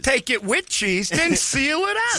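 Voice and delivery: slow, high pitchman voice; Flex Seal guy. Acted. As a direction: stretch out the sales pitch. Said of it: "the Flex Seal guy".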